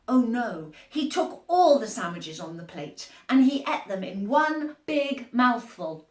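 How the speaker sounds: ordinary delivery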